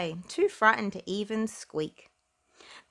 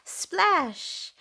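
high splashy exclamation falling away; splash sound; 11 semitones higher than usual. A narrator's reading. Narrator to child listeners, a bright high Splash. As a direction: call out the splash like a sound effect.

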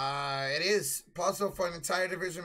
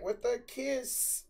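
"With the kiss" slow and higher, cracking on the words; mock voice crack; 7 semitones higher, 3 dB quieter, much slower than usual. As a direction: mimic your own voice cracking on "kiss". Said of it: "I heard her voice crack".

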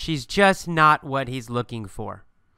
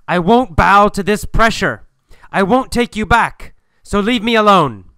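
loud, higher-pitched refusal; yelling; 5 semitones higher, 6 dB louder than usual. Acted. Saren tells his clinging ex to back off, angry yell. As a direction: yell it firmly, word by word. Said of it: "He yells at Julia and says".

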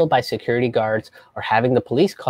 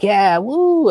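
mock cheer; 7 semitones higher, 4 dB louder than usual. Mocking people's cheer.

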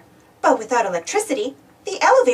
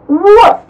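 loud, high dog bark; 7 semitones higher, 13 dB louder than usual. Acted. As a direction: bark it out sharply like a pup. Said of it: "he barked".

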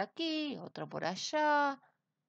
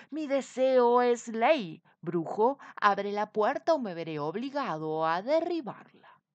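king threatening loudly; commanding king; 3 semitones higher, 10 dB louder than usual. A narrator's reading. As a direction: order him to open up or else. King threatens to break door.

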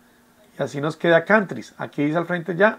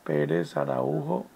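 slow, soft surnames; quiet, drawn-out self-dictation; talking while writing; 9 dB quieter, slower than usual. Real, not acted. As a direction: murmur it slowly as you note it down.

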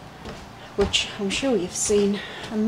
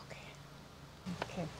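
breathy sneaky whisper; 4 semitones lower, 22 dB quieter, slower than usual. Real, not acted. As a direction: hush it like you're sneaking up.

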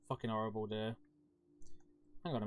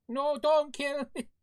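high, loud imitation of an annoyed yell; Cole's annoyed yelling; 12 semitones higher, 6 dB louder, slower than usual. Imitating Cole yelling at the streamer, hammed-up yell. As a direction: draw out a mock panicked yell. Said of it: "she starts yelling at me".

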